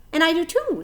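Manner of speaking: high, loud little pledge; tiny spider voice. Acted. Aranea pledging friendship to Wilbur, tiny and high. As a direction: pipe it up proudly.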